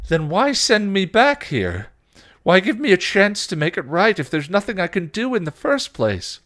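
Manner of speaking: high, loud, desperate demand; anguished Spike. Acted. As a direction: demand answers at full desperate pitch.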